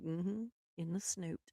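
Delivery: very soft, understated retold reply; quiet confirmation. Woman confirms her silly word to her husband, very quiet matter-of-fact reply. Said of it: "I'm like".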